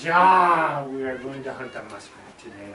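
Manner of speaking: French-Canadian neighbor, loud opening then settling; thick French accent. Retold or imitated. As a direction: announce it in a hammed-up French accent. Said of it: "very thick French accent".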